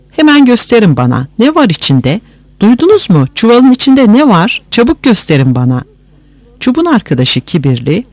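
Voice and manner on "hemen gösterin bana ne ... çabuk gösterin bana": pressing, higher-pitched questioning; insistent demand. A narrator's reading. Wife demands to see inside the sack, insistent. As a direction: press them urgently, pitch raised.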